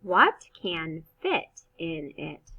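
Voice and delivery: slow question, voice going up at the end; question rise. Shown as a demonstration. Teacher modeling a question for first graders. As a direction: ask it slowly, lifting at the end. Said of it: "We make our voice go up".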